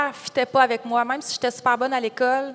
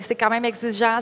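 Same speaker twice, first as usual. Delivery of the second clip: ordinary delivery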